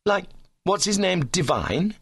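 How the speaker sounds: understated jealous sulk; sullen. A narrator's reading. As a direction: grudgingly bring up the rival. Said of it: "he said sullenly".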